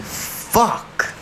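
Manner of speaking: drawn-out, voiceless curse; muted exasperation. Retold or imitated. Server realizing a table will be trouble, muted exasperation. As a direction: breathe the curse out under your breath. Retold inner dread at table's order.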